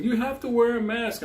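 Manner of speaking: high mock voice of the teller; mocking bank teller. Mimicking the bank teller's demand in a high mocking voice. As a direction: squeak it out like a prissy clerk. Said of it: "She's like".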